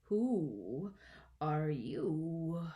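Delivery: slow, drawn-out disdainful caterpillar; languid, scornful drawl; contemptuous. A narrator's reading. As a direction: swoop slowly through each word, with a gap. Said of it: "said the caterpillar contemptuously".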